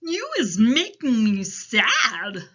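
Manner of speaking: loud, slow giant lament; sad giant. A narrator's reading. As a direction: wail it slowly, stretching the words. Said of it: "rubbing his eyes".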